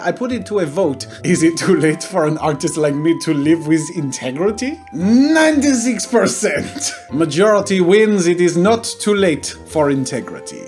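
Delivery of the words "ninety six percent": put-on high, drawn-out French-accented proclamation; theatrical triumph, pitch raised; triumphant announcement. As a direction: proclaim it like a grand victory. Triumphantly announcing the vote result.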